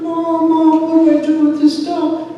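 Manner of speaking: high, loud call for help; panicked child. Retold or imitated. Child bringing the hurt duck to mom, panicked. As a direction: imitate a kid frantic for mom.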